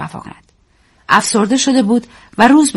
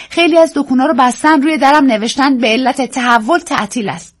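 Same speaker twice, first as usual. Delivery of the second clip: Daisy's voice; 7 semitones higher, 3 dB louder than usual. Acted. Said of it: "به من پیشنهاد کرد".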